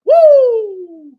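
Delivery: loud, high victory whoop; real celebratory woo; joyful whoop. Real, not acted. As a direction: let out a real cheer of triumph. Celebrating the Mets series win.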